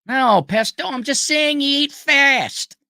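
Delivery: high, loud cartoon sidekick protest; Goodfeathers pigeon impression. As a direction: squawk a drawn-out placating protest like a cartoon pigeon. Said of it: "The good feathers".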